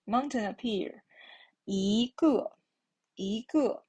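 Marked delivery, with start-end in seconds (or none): 1.7-3.8 一个 as yígè, 一 rising; teacher demonstrating 一个. Shown as a demonstration. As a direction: model 一个 with a rising 一. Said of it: "It should be the rising tone".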